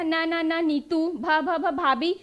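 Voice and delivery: first sound said several times, then नीतू, भाभी; demonstrated stutter. Retold or imitated. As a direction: repeat न before नीतू and भ before भाभी. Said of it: "वहाँ पे क्या कर रहे हैं हमेशा".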